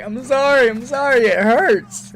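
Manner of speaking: put-on high, loud pleading kid; whiny apology. Son apologizes to his mom, high and whiny. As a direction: beg forgiveness through the pain.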